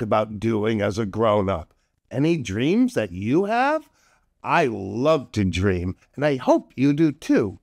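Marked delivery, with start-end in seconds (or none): none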